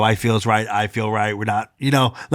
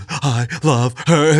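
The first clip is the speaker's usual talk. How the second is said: sobbing daughter; much slower than usual. Retold or imitated. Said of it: "She's sobbing".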